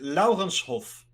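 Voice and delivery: "Laurenshof" said the Dutch way, with a hard r; native Dutch pronunciation. Shown as a demonstration. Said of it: "How do you pronounce Lorenz".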